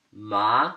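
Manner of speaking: MA gliding upward; second tone. Shown as a demonstration. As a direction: say "ma" with the pitch climbing. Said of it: "Second tone".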